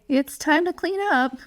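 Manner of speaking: high, pleading voice, unsure of itself; pleading, uncertain. Shown as a demonstration. Parent asking child to clean up, pleading and unsure. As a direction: beg them to clean up instead of telling them. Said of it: "your voice is pleading or uncertain".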